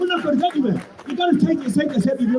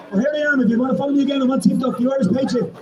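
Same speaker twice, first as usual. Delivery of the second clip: normal talking voice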